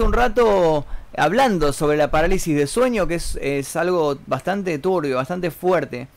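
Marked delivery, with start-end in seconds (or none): none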